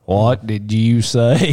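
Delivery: very slow, stretched-out question; exaggerated slow asking. Listener mimicking a hard-to-understand exchange, exaggerated slow question. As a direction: drag every word out, rising at the end. Mocking repeat of teller's question.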